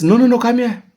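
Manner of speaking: high, insistent no no no; urgent call. Retold or imitated. Uncle urgently calls the boy over. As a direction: call him over fast, worried.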